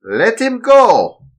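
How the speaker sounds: put-on high, slow, loud German-accented command; ordering in German accent. Villain ordering his men to release Darren, German accent. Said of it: "Mister Walter ordered his two men".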